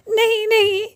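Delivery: high giggle into a denial; laugh, then say it high and amused; mocking laughter. Friend laughing at Kamlesh's question, mocking.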